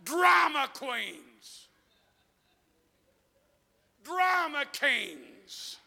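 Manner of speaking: slow loud shout of drama queens; shouted mockery. Preacher mocking dramatic churchgoers with a slow shout. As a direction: call them out, drawn out.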